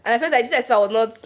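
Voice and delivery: usual speaking voice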